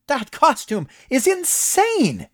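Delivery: high, loud, slow delighted outburst; excited near-shout. A narrator's reading. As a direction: gush over the costume at full volume. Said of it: "Moss all but shouted".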